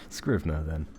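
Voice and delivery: ordinary delivery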